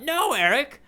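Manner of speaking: a playful outburst at Eric; mock yell. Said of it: "I kinda wanna just yell out".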